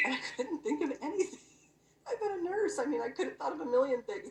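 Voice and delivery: laughing and pitched high; sheepish laugh, pitched high. Real, not acted. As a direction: giggle through the confession. Laughing at herself before God.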